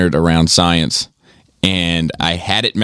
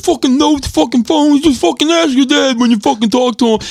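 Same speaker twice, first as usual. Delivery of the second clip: very high, louder mock rant; Joel's voice; 3 dB louder than usual. Friend imitating Joel mocking his phone note. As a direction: scoff it like a fed-up old buddy. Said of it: "He's like".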